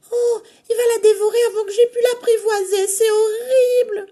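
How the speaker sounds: very high, fast wailing; horrified cry. Acted. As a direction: panic for grandmother. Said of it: "s'écrie le chaperon rouge".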